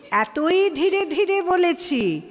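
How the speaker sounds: high, loud, very drawn-out "এতই ধীরে ধীরে"; exaggerated slowness. Reciter mocks own overly slow childhood recital, exaggerated slowness. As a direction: drag out every word of "ধীরে ধীরে" emphatically.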